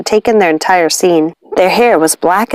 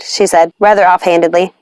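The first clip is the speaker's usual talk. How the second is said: ordinary delivery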